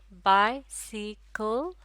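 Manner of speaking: Bicycle in three slow beats, बाइ, सि, कल; syllable by syllable. Shown as a demonstration. Teacher counting syllables in bicycle, slow and separated. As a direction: stretch bicycle out with a gap after each syllable. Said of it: "तीन syllable".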